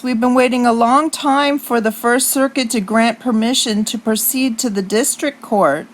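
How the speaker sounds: ordinary delivery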